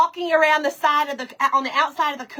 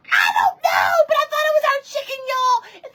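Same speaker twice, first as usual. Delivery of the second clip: high, fast, squealing outburst; worked-up exclamation; 12 semitones higher, 3 dB louder than usual. Real, not acted. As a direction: rush it out in a high pitch. Exclaims over the mistaken loss.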